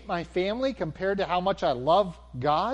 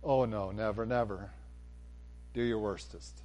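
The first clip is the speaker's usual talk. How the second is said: low, soft, unbothered dare; hushed, low-pitched refusal; quiet defiance; 8 semitones lower, 8 dB quieter than usual. Pretend. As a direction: answer a threat calmly under your breath. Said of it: "I'll laugh at them and say".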